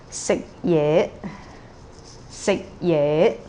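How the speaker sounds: Sik low, ye rising; low then rising tone. Shown as a demonstration. Teacher models sik ye, low then rising. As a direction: start Sik low, let ye rise. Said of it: "low first, and rising the second".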